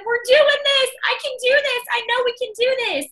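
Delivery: high, emotional declaration; tearful resolve. Retold or imitated. Calling husband, crying, decided.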